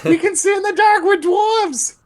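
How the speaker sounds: loud, very high screech; indignant dwarf woman. Acted. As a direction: yell back in a piercing falsetto.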